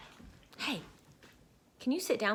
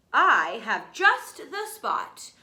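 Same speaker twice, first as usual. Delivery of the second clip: slow, louder brother's reply; Wilbur, loud; 8 dB louder, slower than usual. Acted. Wilbur answering his brother, slow and loud. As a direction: announce it proudly, slowly.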